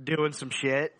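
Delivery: usual speaking voice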